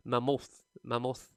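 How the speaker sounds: "mammoth" said as muh-MOOTH, second syllable stressed; student's misplaced stress. Retold or imitated. Teacher copies student's mistake, "mammoth" as muh-MOTH. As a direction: imitate the mispronounced "mammoth", stress shifted to the end. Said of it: "what she kept on saying was".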